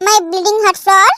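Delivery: husband sweet-talking his angry wife, very slow and low; slow teasing endearment. Acted. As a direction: soothe her with a slow pet name.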